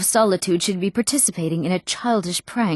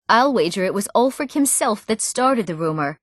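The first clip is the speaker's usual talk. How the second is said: ordinary talk, nothing marked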